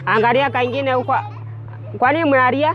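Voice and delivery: calling out. Real, not acted. Loudly addressing schoolchildren.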